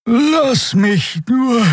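words drawn out into a yawn; slow and sleepy, breaking into a yawn; the healer yawns off the invitation. A narrator's reading. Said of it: "ein Gähnen unterbrach sie".

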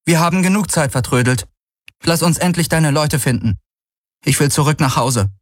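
a hint of a tired young woman; Kirai, curt and hurt. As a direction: keep it brisk to hide the hurt. Narrator voices Kirai.

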